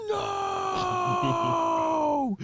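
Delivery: high, loud shouted no; all caps shout. Retold or imitated. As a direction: yell it like typed in caps. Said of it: "Hilly just typed in all caps".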